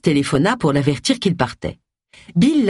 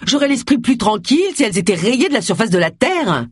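fuming; 5 semitones higher than usual. A narrator's reading. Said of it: "fulmina Agatha".

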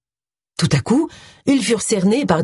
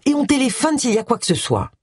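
higher-pitched coaxing plea; pleading; 7 semitones higher than usual. A narrator's reading. Agatha talks her companions into looking first, pleading. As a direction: beg them to agree, voice raised. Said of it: "implora Agatha".

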